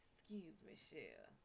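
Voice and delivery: soft, low Cajun drawl imitation; Cajun accent. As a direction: quietly play the Cajun charmer. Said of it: "Mister Jared Leto come around and he's like".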